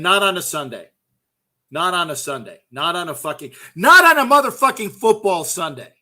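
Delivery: builds from firm to a loud shout on the last line; building rant. Real, not acted. Ranting to viewers about football Sunday, building to a shout. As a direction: escalate each repeat until you explode.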